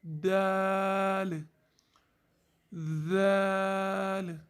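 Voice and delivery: दाल drawn out very slowly, tongue tip on upper teeth roots; slow letter demo. Shown as a demonstration. Teacher slowly demonstrating dal, very drawn out. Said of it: "दोनों दांतों की जड़ को लगेगी".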